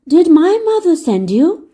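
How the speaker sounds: lightly surprised egg voice; startled at the news; surprised question. Acted. Humpty Dumpty asking Dick, mildly surprised. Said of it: "in surprise".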